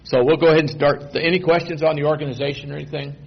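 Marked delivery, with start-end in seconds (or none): none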